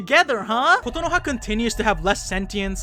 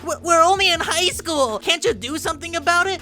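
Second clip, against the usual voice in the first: higher, panicky weaselly excuse; whiny Makoto; 8 semitones higher than usual. Retold or imitated. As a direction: squirm out of blame in a mock-weak voice. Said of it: "and he's like".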